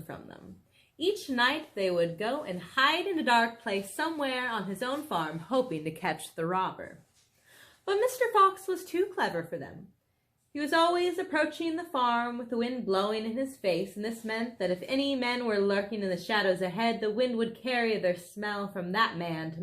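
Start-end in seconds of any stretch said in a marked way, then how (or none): none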